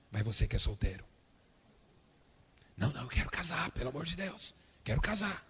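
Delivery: hushed, nervous whisper; whispering servants. Acted. Servants pushing each other to tell David, frightened whisper. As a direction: whisper as if afraid the king will hear. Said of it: "ele vê o povo cochichando".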